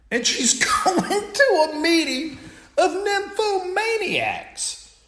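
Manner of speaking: high, laughing through the words; can't keep a straight face, giggling it out. Real, not acted. Narrator cracking up at the punchline, laughing high.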